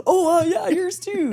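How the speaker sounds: high, loud mocking voice of the flirting guys; a hammed-up gym bro gushing back; mock flirty guys. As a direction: squeal it like a smitten gym bro.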